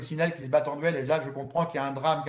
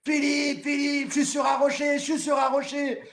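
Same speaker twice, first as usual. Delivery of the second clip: shouting; 9 semitones higher than usual. Retold or imitated. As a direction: call out over the storm. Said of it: "j'entends Jacques qui crie".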